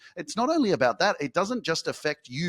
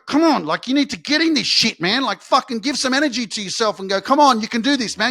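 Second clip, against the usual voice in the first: loud and much higher, all-out hype; hype shout; 7 semitones higher, 7 dB louder than usual. Real, not acted. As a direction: scream at them to get up and go. Hyping listener to shout.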